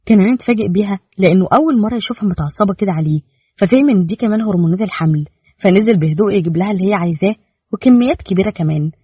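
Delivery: ordinary delivery, nothing marked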